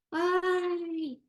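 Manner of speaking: mock exasperation at a bad grade; exasperated sigh. Imitating an impatient father at his child's grade, exasperated.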